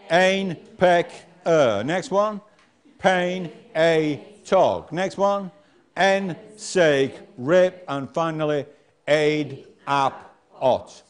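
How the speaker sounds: very slow nonsense syllables; slow sounding out. Shown as a demonstration. Teacher slowly reading nonsense words down the column. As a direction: stretch each syllable slowly. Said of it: "Next one down says".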